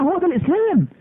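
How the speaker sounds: high, ringing declaration; proud declaration. Real, not acted. Preacher declaring to his audience, high and proud. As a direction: proclaim it with pride.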